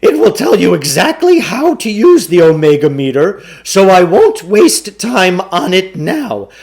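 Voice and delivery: a slick con man pitching the deal; booming carnival barker sell; pushy salesman. A narrator's reading. Klugarsh explains the book.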